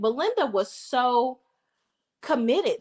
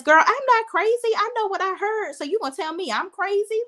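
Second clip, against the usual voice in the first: indignant retort; 11 semitones higher than usual. Retold or imitated. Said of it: "And Fatima says".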